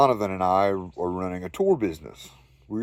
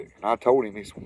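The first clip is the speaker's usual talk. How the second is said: usual speaking voice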